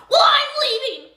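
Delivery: crying shout. Acted. Customer storms out of the store, crying shout. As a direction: wail it on the way out.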